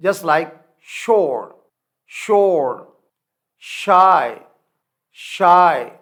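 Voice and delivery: slow, loud شور and شائے with a long sh; sh sound drill. Shown as a demonstration. Teacher showing the sh sound, loud. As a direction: stretch the sh in شور. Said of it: "یہ شین کی آواز پیدا کرتے ہیں".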